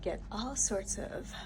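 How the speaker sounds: normal talking voice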